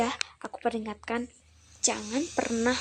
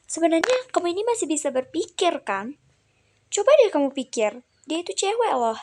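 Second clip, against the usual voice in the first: loud, higher scolding; angry lecture; 4 semitones higher, 7 dB louder than usual. Acted. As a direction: lecture him hotly. Lecturing the harasser.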